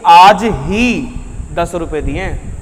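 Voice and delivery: आज ही punched, the rest dropping away; stress on आज ही. Shown as a demonstration. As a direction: focus firmly on आज ही. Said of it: "जैसे मैं यहाँ पर फोकस कर दूँ".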